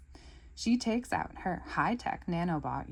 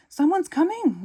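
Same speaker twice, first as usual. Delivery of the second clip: urgent warning; 12 semitones higher, 10 dB louder than usual. Acted. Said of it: "warns Lucy".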